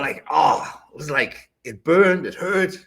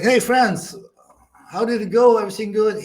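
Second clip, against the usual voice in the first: cheerful greeting; 7 semitones higher, 5 dB louder than usual. Retold or imitated. Greeting Franz at championship.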